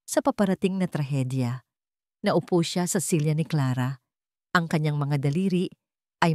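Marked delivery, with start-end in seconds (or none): none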